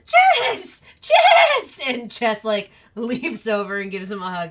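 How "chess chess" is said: shrieking high and loud, laughing; screeching for help, high and loud; panicked shout. Acted. March Hare cries out for Chess.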